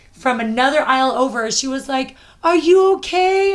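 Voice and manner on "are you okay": high, loud, drawn-out concern; imitated worried call, pitched way up. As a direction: re-enact a stranger anxiously checking on someone. Stranger rushing to check on her.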